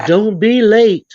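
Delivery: slow, loud stern warning. Retold or imitated. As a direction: warn her firmly, drawn out. Mother warns daughters.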